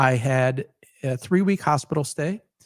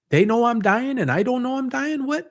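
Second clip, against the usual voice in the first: bewildered fear; 7 semitones higher than usual. Retold or imitated. Recalling fear of dying.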